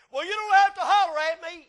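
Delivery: high, loud whiny back-talk; sassy child. Retold or imitated. Child talks back to parent, high and whiny. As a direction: whine back like a mouthy kid. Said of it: "one of your children".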